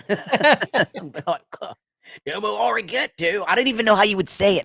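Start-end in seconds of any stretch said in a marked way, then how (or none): 2.3-3.4 mock Australian accent. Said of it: "if she has an Australian accent". 3.5-4.6 put-on trying an Australian accent. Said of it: "if she has an Australian accent".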